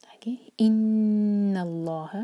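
slow with ghunna on नून; Quran recitation. Shown as a demonstration. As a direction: hum the नून of इन्नल्लाह. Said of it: "नून पर गुन्ना".

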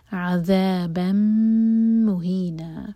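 عَذَابًا مُهِينًا with the م held nasally for two seconds; slow, merging the tanween into a long م; held meem. Shown as a demonstration. Teacher shows the held meem, slow and clear. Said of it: "میم کو hold کریں گے دو seconds".

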